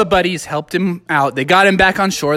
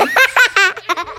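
high-pitched acted giggle; giggling; 12 semitones higher than usual. Missus Gigglesworth sure she will win, high giggling. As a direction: giggle like you know a secret. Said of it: "Missus Gigglesworth started giggling".

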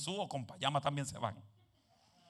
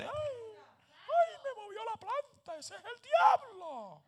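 high, put-on voice of a jumpy churchgoer, slowed; mocking a scared believer; 7 dB louder, much slower than usual. Preacher mocking people who blame the devil for a moving plant. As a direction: squeal at every little noise like a frightened church lady. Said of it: "Esto oye gente".

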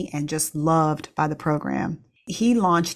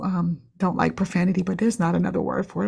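normal talking voice

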